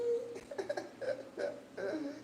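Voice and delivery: high laugh close to crying; teary laugh. Real, not acted. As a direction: laugh through happy tears. Moved by customers' praise.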